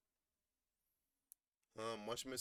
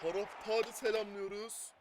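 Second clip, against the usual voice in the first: football commentator; 8 semitones higher, 4 dB louder, slower than usual. Pretend. Slow commentator call after goal.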